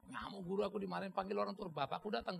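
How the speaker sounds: ordinary delivery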